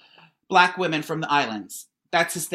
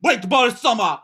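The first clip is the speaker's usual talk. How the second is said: much higher, louder mock island-accent rapping; hammed-up imitation of the rapper son; 6 semitones higher, 5 dB louder, slower than usual. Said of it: "Chet comes out".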